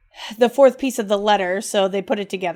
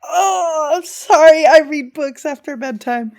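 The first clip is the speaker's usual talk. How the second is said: teary reading; 6 semitones higher, 7 dB louder than usual. Real, not acted. Choked up reading letter.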